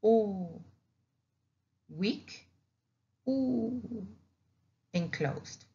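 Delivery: a soft, closed "U" set against the open A; closed vowel demo. Shown as a demonstration. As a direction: say "U" quietly with the mouth almost closed.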